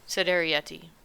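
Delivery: ordinary delivery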